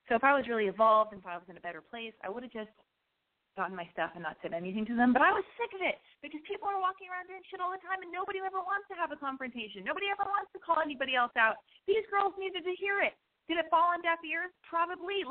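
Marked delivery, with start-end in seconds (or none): 5.1-15.3 higher, heated rant; fired up about nobody calling people out; worked-up rant. Real, not acted. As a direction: get on your soapbox. Ranting about confrontation.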